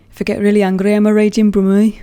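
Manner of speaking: slipping into a Brummie accent on raging Brummie. Shown as a demonstration. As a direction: let the Birmingham accent come through.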